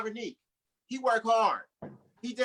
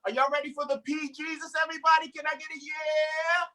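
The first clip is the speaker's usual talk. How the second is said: high, loud hype-man intro; hype announcer; 8 semitones higher, 4 dB louder, slower than usual. Real, not acted. Host hyping the crowd for the next comedian. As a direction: work the crowd up.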